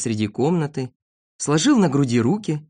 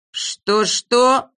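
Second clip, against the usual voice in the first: higher-pitched, mild outcry of disbelief; indignant shout; 7 semitones higher, 3 dB louder than usual. A narrator's reading. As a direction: exclaim in indignant surprise. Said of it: "закричал Знайка". (возмущённый вскрик)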